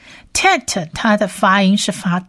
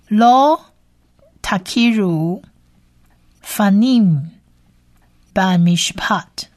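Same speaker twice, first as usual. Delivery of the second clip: Hebrew verse read word by word with long pauses; measured language-teacher model reading; careful recitation. Shown as a demonstration. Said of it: "我们来把这一节经文呢多念几次".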